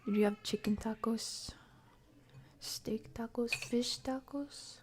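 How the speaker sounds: ordinary delivery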